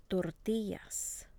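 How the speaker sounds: "Tortillas" slowly, weight on TI; stress next to last syllable. Shown as a demonstration. Teacher models the rule for repeating, stress next to last syllable. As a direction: draw out "Tortillas", leaning on the middle syllable. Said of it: "stress next to the last syllable".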